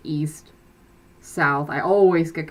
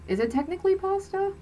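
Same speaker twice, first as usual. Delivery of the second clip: incredulous; 14 semitones higher, 4 dB quieter, faster than usual. Real, not acted. Rhetorical question, pitch leap.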